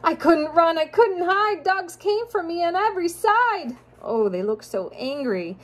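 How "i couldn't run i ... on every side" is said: sing-song rhyming storybook read; reading to children. A narrator's reading. Parent reading a rhyming picture book to a child, sing-song. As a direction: lilt the rhymes for a child listener.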